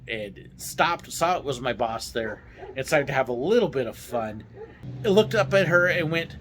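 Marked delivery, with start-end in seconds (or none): none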